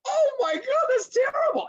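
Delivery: a sudden very high, loud cry of horror; shocked cry. Retold or imitated. Opera-goer finally grasping the tragic story, crying out very high and loud. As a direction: gasp it out as if the opera's ending just hit you. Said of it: "I go".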